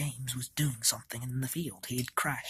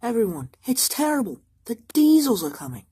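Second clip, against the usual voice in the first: alarmed shout; 8 semitones higher, 11 dB louder than usual. Acted. Engine rushes in warning others.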